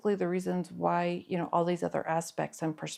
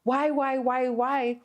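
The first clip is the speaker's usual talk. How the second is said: put-on wailing child; 6 semitones higher, 3 dB louder than usual. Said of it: "the child is screaming and wailing".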